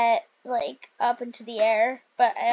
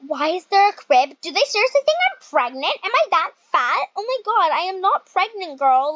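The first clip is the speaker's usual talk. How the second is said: higher, louder, fast indignation; shocked outrage; 5 semitones higher, 5 dB louder than usual. Real, not acted. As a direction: protest in disbelief. Shocked at crib in room.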